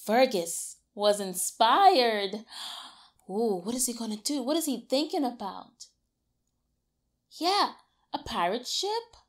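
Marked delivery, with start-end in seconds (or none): none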